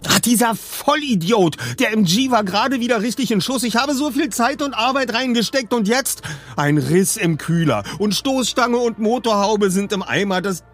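high, agitated tirade; angry rant. A narrator's reading. Peter rails at the rider who wrecked his car, furious. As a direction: rant in exasperated outrage.

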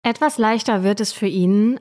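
ordinary talk

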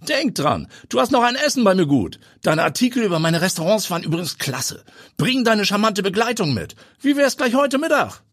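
high, jovial chatty voice; a cheerful, chummy star chef; Eduard von Apel. Acted. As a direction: chef inviting the journalist to lunch, jovial.

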